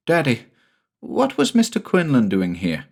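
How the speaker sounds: lightly voiced young son; little boy Jamie. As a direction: ask like a small boy puzzled.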